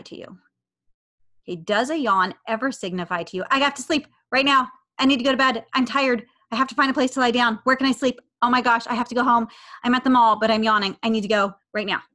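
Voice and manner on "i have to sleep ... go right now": loud, higher-pitched, hammed-up urgency; exaggerated frantic self-talk; mock panic. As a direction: play a yawn as a dire emergency, easing in pitch towards the end. Mocking a panicked reaction to yawning.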